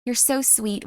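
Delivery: soft, gushing mother voice; hushed maternal praise; loving mom. Acted. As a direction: dote on your child softly and tenderly. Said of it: "Don't you want a mom that's just like".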